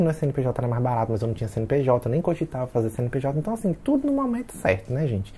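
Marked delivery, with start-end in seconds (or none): none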